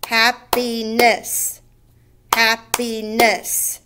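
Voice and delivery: "Happiness" slowly as hap, pi, ness; three separate beats. Shown as a demonstration. Teacher counting three syllables, "Happiness" beat by beat. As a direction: chop "Happiness" into three slow, even beats. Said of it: "three syllables or three beats".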